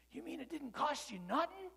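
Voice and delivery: put-on high, loud kid's disbelief; a street kid who can't believe it; amazed little boy. Kid amazed car was free.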